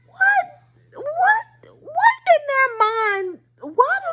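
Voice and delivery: very high, drawn-out incredulous question; hammed-up high-pitched puzzlement; mock bafflement. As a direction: squeal it in exaggerated disbelief, stretching the last words. Mock bafflement about old ladies.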